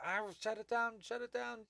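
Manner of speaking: high, panicked cry from off in the distance; a worker yelling to shut it down; distant panicked worker. Acted. Worker calls from afar to shut it down, panicked high cry.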